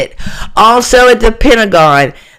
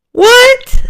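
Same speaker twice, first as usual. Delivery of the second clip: much higher, sharp disbelief; as if you can't believe your ears; incredulous what. Real, not acted. Incredulous outburst at the claim.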